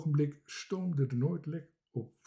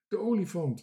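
called out, higher-pitched; Marinka; 6 semitones higher, 4 dB louder than usual. A narrator's reading. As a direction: cry out a sudden idea. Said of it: "riep Marinka".

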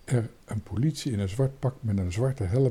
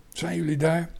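higher-pitched questioning call; mild narrated call; calling inside; 6 semitones higher than usual. A narrator's reading. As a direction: lightly call in to check who is there. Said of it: "riep Toontje naar binnen".

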